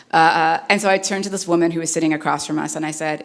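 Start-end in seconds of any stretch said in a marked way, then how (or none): none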